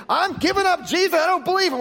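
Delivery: hammed-up high mock declaration; mock renouncing Jesus. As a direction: squeal it out as a scornful caricature. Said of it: "At least say".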